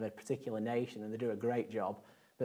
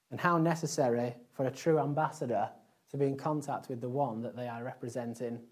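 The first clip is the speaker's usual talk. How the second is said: ordinary talk, nothing marked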